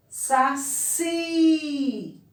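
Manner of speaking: Saci with a high, long final CI; stress on last syllable. Shown as a demonstration. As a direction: stretch out the CI in Saci. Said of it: "a sílaba pronunciada com mais força".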